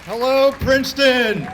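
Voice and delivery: greeting the crowd. Real, not acted. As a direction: hail the whole crowd. Speaker greets rally crowd.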